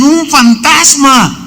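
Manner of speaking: high, slow, panicked shout; scared cry. Retold or imitated. Frightened disciples shouting at a ghost, high slow panicked cry. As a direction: call out in terror at the shape on the water. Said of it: "Estaban espantados y decían".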